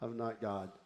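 low, soft realization; quiet admission. Acted. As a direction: admit it quietly, dropping the voice. Repeats realisation more quietly.

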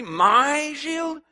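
high, loud furious bark; furious demand. A narrator's reading. Training officer furiously demanding of the boy. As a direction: demand it in fury. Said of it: "he demanded furiously".

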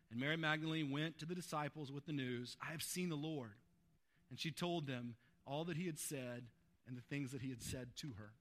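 soft, low, winding down; hushed reading. A narrator's reading. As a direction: close the reading low and quiet. Closing the scripture reading softly.

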